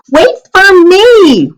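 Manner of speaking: put-on a small girl left behind, wailing after them; wailing little girl. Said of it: "wailed little Kirsty".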